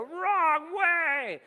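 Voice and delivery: yelling at a wrong-way driver; calling out. Retold or imitated. Neighbor yells at a wrong-way car, loud shout. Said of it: "we stand out there and we go".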